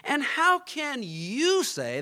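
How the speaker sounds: YOU stressed in a high, drawn-out challenge; slow right down and throw the weight on YOU; emphatic. Acted. Crowd challenging what Jesus said, emphatic. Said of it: "that's also emphatic".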